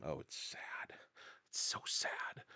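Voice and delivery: whispered, hammed-up lament about aging; mock sad whisper. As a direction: sigh it out in a playful, self-pitying whisper. Mock lament about getting older.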